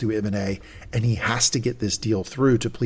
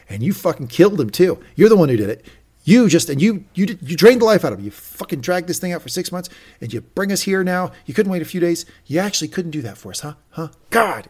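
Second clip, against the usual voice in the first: raised, louder accusing rant, pitched up; angry retold rant; 5 semitones higher, 5 dB louder than usual. Roman raging at Mattson over his father's death, heated. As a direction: hurl the blame at him, heated.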